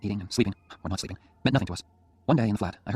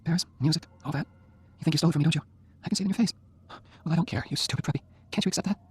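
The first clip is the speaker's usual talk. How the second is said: frail teasing scold; 8 semitones higher than usual. A narrator's reading. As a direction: scold him tenderly from the bed. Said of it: "Jenny scolding Oliver".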